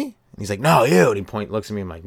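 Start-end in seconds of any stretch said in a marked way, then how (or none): 0.6-1.1 loud, higher-pitched correction; insistent call. Retold or imitated. Street man singles out the other friend, insistent call. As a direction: call out past the wrong person.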